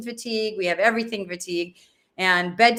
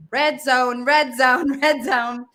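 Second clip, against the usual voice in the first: laughing alarm; 4 semitones higher, 4 dB louder, slower than usual. Retold or imitated. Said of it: "naming her own anger".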